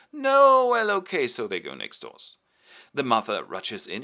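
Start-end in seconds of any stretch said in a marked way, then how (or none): 0.1-1.2 high, loud protest that caves; reluctant girl. Acted. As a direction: protest then give in. Daughter reluctantly gives in.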